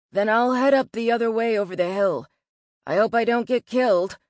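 loud, jokey send-off; tossed out like a joke. A narrator's reading. Nick heads over the hill to search, joking loudly. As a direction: toss it out loudly, half joking. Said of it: "He tossed the words out like a joke".

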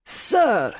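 sharp outraged exclamation. Acted. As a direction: snap it out in outrage. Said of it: "Outrage permeated the man's voice".

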